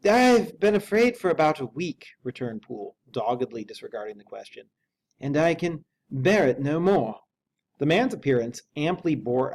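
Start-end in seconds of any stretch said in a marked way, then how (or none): 0.1-2.1 high, loud, fearful servant voice; a scared manservant, high and loud; frightened butler. A narrator's reading. Poole's anxious voice in story. 5.2-7.2 slow, loud, despairing servant; high, slow, loud and desperate; desperate butler. A narrator's reading. Poole's anxious voice.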